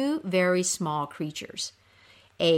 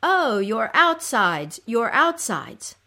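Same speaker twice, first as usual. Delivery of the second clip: slow, slightly raised lament; echoed cry; 4 semitones higher, 5 dB louder, slower than usual. Acted. Creatures crying along with the suffering queen, echoed cry. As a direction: cry along with her, drawing it out. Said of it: "you will also cry".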